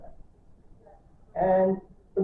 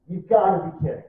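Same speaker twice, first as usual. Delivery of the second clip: imitating Sarah Frost's disbelief, louder; a stunned "You've gotta be kidding", punched out; incredulous; 8 dB louder than usual. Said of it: "she goes".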